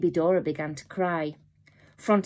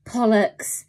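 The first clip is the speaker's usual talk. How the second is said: cursing; 5 semitones higher, 5 dB louder than usual. A narrator's reading. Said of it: "Then he cursed".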